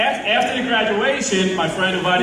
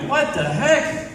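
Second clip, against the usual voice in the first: surprised; 6 semitones higher, slower than usual. Retold or imitated. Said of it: "I'm like".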